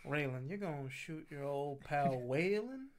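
slow, soft Southern accent, lightly put on. Friend mocking Justified dialogue in a slow Southern accent. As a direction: drawl it out in a mock Southern accent. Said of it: "With a southern accent".

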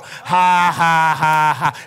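loud, steady mock guffaw; demonstrated forced laugh. Shown as a demonstration. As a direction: answer with a deliberate belly laugh. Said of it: "So now start it. Learn it".

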